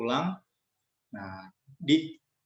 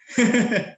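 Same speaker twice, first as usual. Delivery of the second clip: loud, higher bright laugh; burst of laughter; 8 semitones higher, 6 dB louder than usual. Real, not acted. The teacher laughing at her own joke to the children, loud laugh. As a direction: crack up at the silly gossip line.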